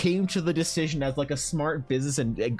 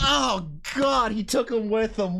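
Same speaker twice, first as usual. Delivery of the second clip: excited shout; 11 semitones higher, slower than usual. Acted. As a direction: shout it like the best twist ever. DM reliving Yavuz's sacrifice.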